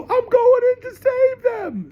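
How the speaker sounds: very high, slow falsetto; lofty high pretend heroine; mock woman voice. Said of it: "She doesn't go".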